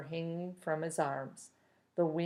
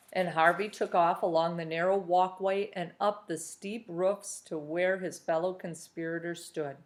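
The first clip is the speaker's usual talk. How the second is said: ordinary delivery, nothing marked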